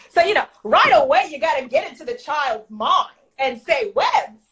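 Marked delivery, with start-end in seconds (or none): 3.9-4.4 webs squeaked far higher, rising; incredulous high question; mock astonished counselor; baffled webs.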